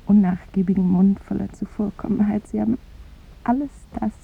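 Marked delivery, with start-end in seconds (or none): none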